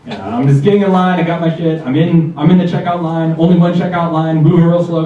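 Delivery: ordinary talk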